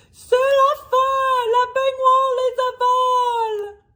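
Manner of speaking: high, loud panicked scream; Trouillette screaming. Acted. Scared Trouillette screams to her friends in panic. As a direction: shriek it as if the bath will swallow you. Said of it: "Trouillette a hurlé".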